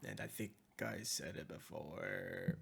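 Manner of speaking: usual speaking voice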